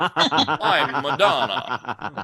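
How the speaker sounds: hammed-up impersonator stretching every word slowly. Mocking the open-mic guy, a slow bad [name] [name]. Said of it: "every impression sounded like a bad [name]".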